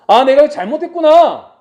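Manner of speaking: loud, high cry of sudden regret; acted realization. Preacher voicing a sinner's realization, loud. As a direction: burst out as if it just dawned on you.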